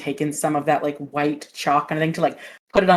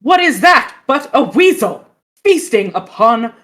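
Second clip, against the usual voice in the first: hammed-up cry of alarm; 3 semitones higher, 8 dB louder, slower than usual. Sparrow spots weasel.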